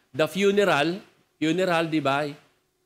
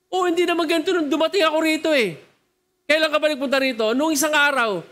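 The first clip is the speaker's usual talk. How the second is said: higher, faster, surprised back-and-forth; shocked protest; 6 semitones higher, faster than usual. Retold or imitated. Politician shocked by hell arguing with the demon, fast and high. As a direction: rattle off the dismay at the flames.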